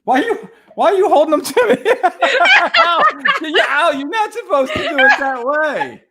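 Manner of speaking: high laughing protest; giggling through the words. Real, not acted. Laughing at handed scissors.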